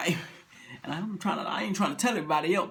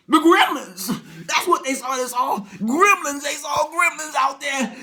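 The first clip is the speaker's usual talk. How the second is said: louder, higher blurt; shouted gremlins; 6 semitones higher, 6 dB louder than usual. Acted. Husband shouts the gremlin theory at his wife. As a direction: burst out with it.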